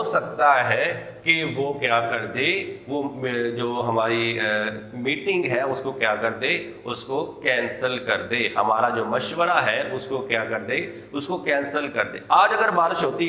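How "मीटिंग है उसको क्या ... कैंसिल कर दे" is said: ordinary talk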